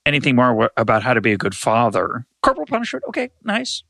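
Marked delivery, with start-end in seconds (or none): 2.4-3.8 high, soft throwaway "okay, nice"; a hint of ironic approval; mock dismissive aside. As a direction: wave it off lightly with a high, quiet voice.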